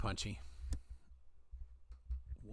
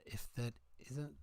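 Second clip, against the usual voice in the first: a light Scottish lilt on if that isn't; Scottish accent. Pretend. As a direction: try the line in a Scottish accent. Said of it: "just say in a Scottish accent".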